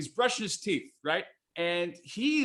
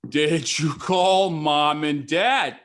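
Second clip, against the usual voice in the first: slow, louder, spelled-out repeat; slowed, louder repeat to his confused mother; slow and deliberate; 5 dB louder, much slower than usual. Retold or imitated. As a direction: say it slowly so she follows. Asking again, slower.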